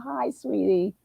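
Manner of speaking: ordinary talk, nothing marked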